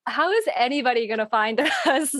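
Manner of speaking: usual speaking voice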